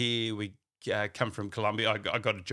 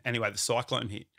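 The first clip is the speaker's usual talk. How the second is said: ordinary delivery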